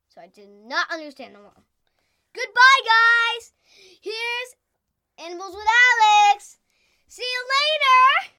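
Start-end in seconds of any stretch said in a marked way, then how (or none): 2.3-3.5 long, loud, high shouted goodbye; stretched loud sign-off yell; big goodbye shout. Real, not acted. 4.0-8.3 excited outro. Real, not acted. Show outro called out.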